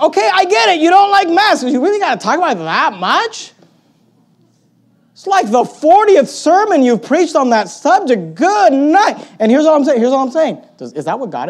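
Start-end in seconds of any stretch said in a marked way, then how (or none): 0.0-3.5 high, loud mock exasperation; fed up with a repetitive complainer; incredulous, pitched-up complaint; exasperated retort. 5.1-9.2 slow, high-pitched exasperation; hammed-up weary disbelief; exasperated. Exasperated at repeated sermons.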